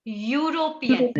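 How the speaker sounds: slow यूरोपियन, opening on a Y consonant; demonstrated Y sound. Shown as a demonstration. Teacher repeating European so students hear the first sound, slow Y onset. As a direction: draw out यूरोपियन so the initial "yu" is heard. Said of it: "मैंने क्या बोला?".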